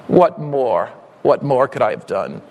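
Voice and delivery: hushed, aching tender appeal; tender plea. Acted. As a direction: breathe the question gently. Soft low repeat of refrain.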